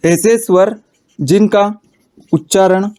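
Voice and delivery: ऐसे स्वर, जिनका, उच्चारण each said alone with long pauses; word by word dictation. Shown as a demonstration. Teacher dictating to students, slow word-by-word pauses. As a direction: spell out ऐसे स्वर जिनका उच्चारण slowly for note-taking. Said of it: "dictating word by word to class".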